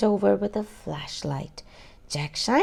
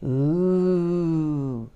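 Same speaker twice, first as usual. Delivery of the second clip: ghost voice; 2 semitones lower than usual. Acted. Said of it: "he sounded just like a ghost".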